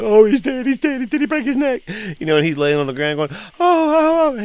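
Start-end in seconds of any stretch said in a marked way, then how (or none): 0.0-1.8 much higher, louder frantic cry; imitating a terrified grandmother; panicked grandma. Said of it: "she's like". 3.3-4.4 much higher, louder oh oh oh groans; mock winded boy yelling. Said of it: "He's yelling".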